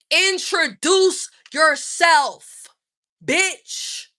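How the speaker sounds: slow, loud, high scolding; angry scolding. Real, not acted. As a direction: spit it out furious at him. Ranting at rude man.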